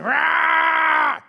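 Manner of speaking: high, loud furious yell; angry shout. Retold or imitated. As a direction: bark at the sheep like a butcher. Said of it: "beating the sheep and screaming".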